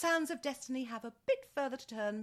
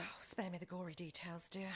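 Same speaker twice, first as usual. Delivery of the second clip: weary aside; 6 semitones lower, 9 dB quieter than usual. Acted. Dismissive aside to secretary.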